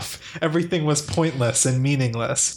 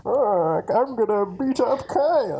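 muffled Bane voice, higher; into a cup; 7 semitones higher than usual. Pretend. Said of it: "Can you do Bane?".

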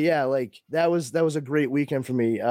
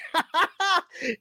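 high-pitched burst of laughter; high cackling laugh; retold laughter. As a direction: reenact cracking up. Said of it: "Like, I laughed".

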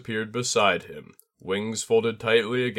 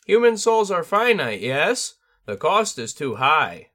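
high tengu voice thick with concern; worried bird spirit regretting his lesson; 9 semitones higher, 6 dB louder than usual. A narrator's reading. Tengu worried for his student, high and concerned. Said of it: "its voice thick with concern".

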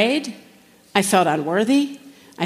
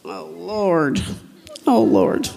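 a lightly groaning imitation of a weary prayer; mock moaning prayer; slower than usual. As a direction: sigh out the words as an old habit. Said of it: "This is how I used to begin all my prayers".

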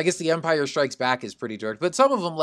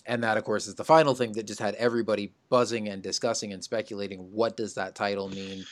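ordinary talk, nothing marked

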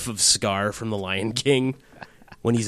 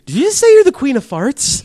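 high loud disbelieving question; incredulous; 12 dB louder than usual. Retold or imitated.

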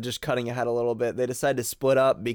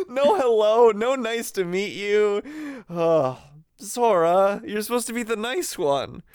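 playful scolding; 8 semitones higher than usual. Real, not acted. Mock scolding Sora.

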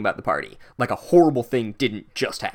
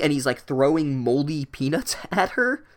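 ordinary talk, nothing marked

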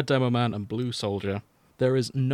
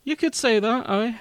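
put-on Scottish accent; 12 semitones higher, 4 dB louder than usual. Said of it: "Scottish accents".

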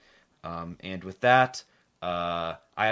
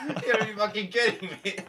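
mock disbelief; 12 semitones higher than usual. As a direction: blurt it out in fake astonishment. Mock outrage at news.

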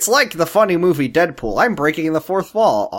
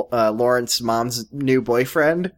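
ordinary talk

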